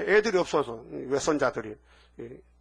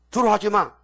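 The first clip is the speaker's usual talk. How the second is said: commanding call; 6 semitones higher, 7 dB louder than usual. Acted. God voiced commanding courage.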